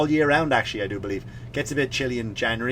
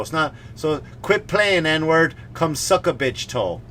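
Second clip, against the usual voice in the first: normal talking voice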